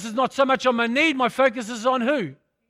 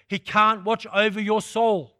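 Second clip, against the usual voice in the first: ordinary talk, nothing marked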